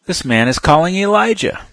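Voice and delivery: loud, high exclaim; bystander call. Acted. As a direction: call it out to the others. Bystanders react to the cry.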